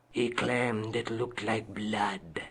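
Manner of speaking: slow, low hushed whisper. Acted. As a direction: breathe it like a secret. Spooky tale of a bloodstained statue.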